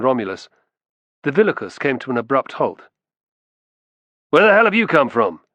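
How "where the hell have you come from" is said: loud, higher, suspicious challenge; suspicious demand. Acted. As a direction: bark it out with suspicion. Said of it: "he demanded suspiciously".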